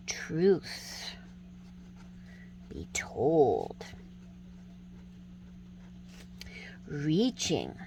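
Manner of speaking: soft slow whispered poem; poem reading. Acted. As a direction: breathe the lines out gently. Reads acrostic poem slowly.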